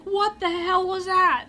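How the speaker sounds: high, loud, drawn-out shriek; panicked outburst. Retold or imitated. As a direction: cry out in re-enacted shock. Re-enacts panic after fleeing monster.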